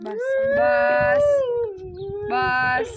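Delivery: high, long drawn-out comforting words; soothing. Real, not acted. Hushing a weeping elderly woman, high drawn-out soothing.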